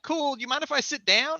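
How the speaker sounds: overly polite and chill; mock casual. Him asking permission to sit, high and casual. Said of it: "And I'm like".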